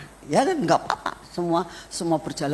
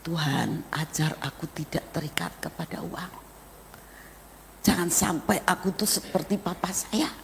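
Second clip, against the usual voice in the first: soft prayer; 8 dB quieter than usual. Retold or imitated. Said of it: "Saya bilang".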